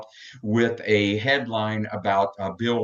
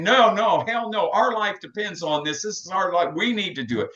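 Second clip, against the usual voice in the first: defiant protest; 9 semitones higher than usual. Retold or imitated. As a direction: throw it back at them, high and heated. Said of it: "the alcoholics are saying".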